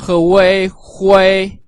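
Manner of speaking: he, wei, then hui, slowly built up from its sounds; slow teaching breakdown of he wei hui; spelling out hui. Shown as a demonstration. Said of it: "U I pronounce wei".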